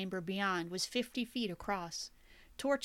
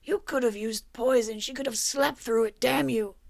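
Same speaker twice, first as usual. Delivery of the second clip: louder, accusing yell; angry shout; 3 semitones higher, 9 dB louder than usual. A narrator's reading. Victor accuses the killer who murdered the duchess, shouting. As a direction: hurl the blame at him.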